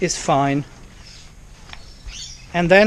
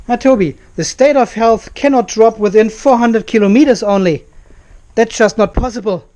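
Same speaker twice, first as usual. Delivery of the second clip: exasperated protest; 5 semitones higher, 6 dB louder than usual. Real, not acted. Protesting to Toby about battery.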